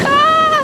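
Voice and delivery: sharp high yelp; yelp on hot sand. Real, not acted. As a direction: cry out as feet burn.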